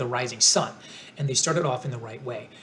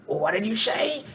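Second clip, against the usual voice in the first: Scarecrow voice; 8 semitones higher than usual. A narrator's reading. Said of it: "asked the Scarecrow".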